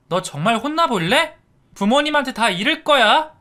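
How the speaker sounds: high, loud nagging; scolding big sister. A narrator's reading. Sister scolding her brother for misbehaving, high and loud. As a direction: tell off your little brother. Sister threatening to tell parents.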